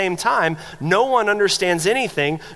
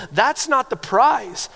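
slow, higher, leaning hard on not; emphatic correction; 5 semitones higher, slower than usual. Real, not acted. Preacher insisting to the church that it's not the prize, slow and stressed. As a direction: say it so nobody misses that it isn't the point.

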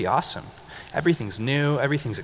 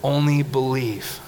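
drawn out, every word weighted; slow, loud congregation recital; 2 semitones higher, much slower than usual. Shown as a demonstration. Preacher leading the church in a slow, loud recital. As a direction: lead the room in saying it slowly. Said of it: "One more time".

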